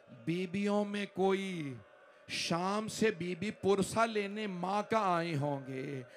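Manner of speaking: ordinary talk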